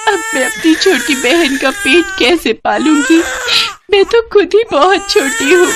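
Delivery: high crying despair. Acted. Girl alone with her baby sister, high crying. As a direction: weep helplessly, voice high.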